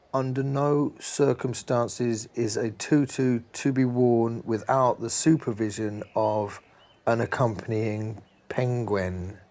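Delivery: slow, deliberate rule-reading; reading a formal sign. Acted. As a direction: read the sign slowly like an official notice.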